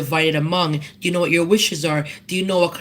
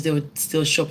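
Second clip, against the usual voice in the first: usual speaking voice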